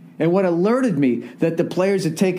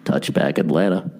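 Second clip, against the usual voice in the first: much lower, deadpan announcer call; stadium announcer; 13 semitones lower than usual. Retold or imitated. Comic imitating the stadium announcer's anticlimactic call. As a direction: drop into a flat announcer voice. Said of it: "the announcer just goes".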